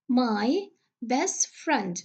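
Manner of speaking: "my best friend" drawn out slowly with the T dropped from "best"; a slow teaching repeat of "my best friend"; slow model phrase. Shown as a demonstration. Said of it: "the te sound will be elided".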